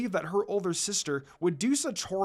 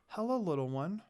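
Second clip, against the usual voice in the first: softer, gentle hello; kind greeting; 5 dB quieter than usual. A narrator's reading. Ayame greeting frightened Rin, soft and kind. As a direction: coax a scared child softly. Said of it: "she greeted kindly".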